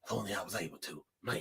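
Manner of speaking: very low, hushed, wistful murmur; Uncle Rico. Acted. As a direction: brood quietly over lost glory days. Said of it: "that's how Rico sound when he's talking".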